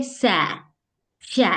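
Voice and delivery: drawn-out सै then शै; imitating the student's सै शै; student's s-sh drill. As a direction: stretch सै and शै apart. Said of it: "वो बोलती है".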